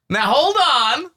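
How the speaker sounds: "Now hold on." very slow, high and loud; mock grand warning; slow dramatic halt. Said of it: "You say".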